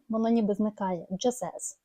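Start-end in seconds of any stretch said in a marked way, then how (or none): 1.1-1.8 soft "Just as" with no t, "jusas"; dropped t. Shown as a demonstration. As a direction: swallow the t in "Just as". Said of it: "T не чутно".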